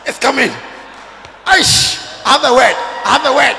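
hammed-up prophet, very loud bursts; mock prophetic shouting. Preacher mimicking a prophet receiving a word, hammed-up shouting. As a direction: shout it like a word just arrived.

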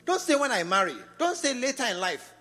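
emphatic warning. Real, not acted. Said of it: "preaching emphasis".

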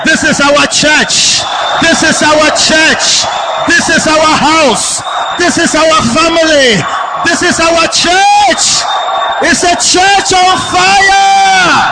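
high, slow, drawn-out proclamation; rallying preacher's shout. Real, not acted. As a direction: shout each line as a rally cry. Rallies congregation at full force.

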